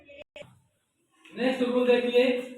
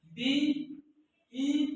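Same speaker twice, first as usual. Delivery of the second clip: slow spelling; 3 semitones higher, 3 dB quieter, much slower than usual. Shown as a demonstration. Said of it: "जैसे receive को देखिए".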